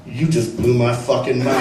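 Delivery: deep and hushed in awe; low, stunned voice. Retold or imitated. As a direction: say it like your world just cracked. Stunned homeless man quoted.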